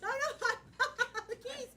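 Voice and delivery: high, stammering mock panic. Nervous diver to partner on remembering home, panicked. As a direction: fret in a fluster, tripping over words.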